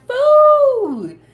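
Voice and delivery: high excited shout. Retold or imitated. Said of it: "she was so excited".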